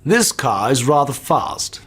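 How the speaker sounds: slow, plummy upper-class English; lightly posh, drawn-out English gentleman; posh British accent. Shown as a demonstration. Actor asked for a posh accent, slow plummy English. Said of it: "In a posh accent?".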